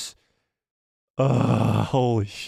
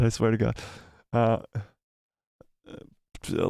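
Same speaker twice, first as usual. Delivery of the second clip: usual speaking voice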